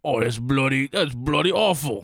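hammed-up Australian disapproval; mock Australian accent. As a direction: grumble as an Australian listener. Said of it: "Any Australian listeners".

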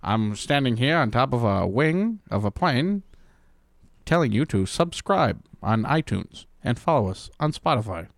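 mock nineteen-twenties announcer; [name]. Co-host atop a plane wing plugging the podcast, mock announcer voice. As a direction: play an old-time broadcaster, hammed up. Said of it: "this is [name]".

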